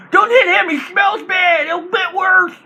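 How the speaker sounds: very high, loud taunting shout; trash-talk shout. Acted. Goblin bard heckling a foe, high shouted taunt. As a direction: yell the insult across the fight. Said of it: "talk trash too".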